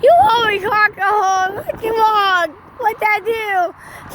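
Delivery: high, pleading call; calling after a truck. Real, not acted. Kid calls to the truck driver, high and pleading.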